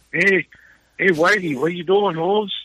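high-pitched mock homeboy call-out; street tough. Imagined local accosting him as he runs, mock street-tough voice.

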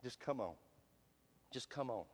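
near-whispered, low and soft; tender invitation. Retold or imitated. The Lord calling the struggling believer, very soft and low. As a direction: beckon gently, almost breathed. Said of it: "the Lord stands in front of us and says".

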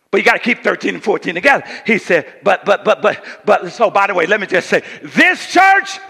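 loud, high, shouted preaching; full-force preaching. Acted. Pastor voicing baffled family members to the church, loud and high. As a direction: thunder at the congregation.

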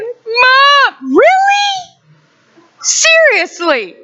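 high, loud whining protest; whiny kids. Retold or imitated. Family voiced complaining about her.

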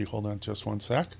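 quiet, mid-sip aside; while sipping a drink. Real, not acted. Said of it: "Oh, another sip".

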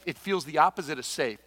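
ordinary delivery

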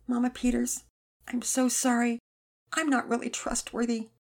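lightly voiced girl, apologetic; sorry girl.

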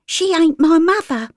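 put-on high, defiant girl; a child denying her keeper; street girl. Flora denies Gert is her mother, high.

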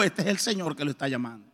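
low, soft pastoral voice; a hint of a grave pastor; pastor's solemn verdict. Pastor telling him it is the Lord calling, low and soft. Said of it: "cayó en cuenta y dijo".

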